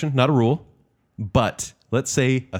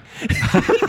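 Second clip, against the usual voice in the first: high-pitched laugh. Real, not acted. High laugh at own rant.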